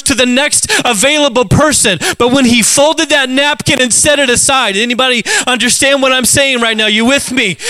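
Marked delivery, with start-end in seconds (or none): none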